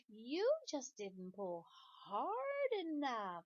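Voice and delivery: slow, sly little pigtail. Acted. Pigtail coaxing Paul to pull harder, slow and sly. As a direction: tempt him slowly and slyly.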